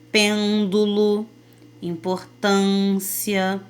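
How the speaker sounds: pêndulo and importância said slowly, closed ê and â; slow demo words. Shown as a demonstration. Teacher showing the closed circumflex sound, slow and deliberate. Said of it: "Pode notar que a sua boca se fecha".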